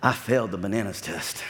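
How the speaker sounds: low-pitched imitation of a sheepish confession; serious confession. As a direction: own up gravely, as the retold friend. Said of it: "He was serious".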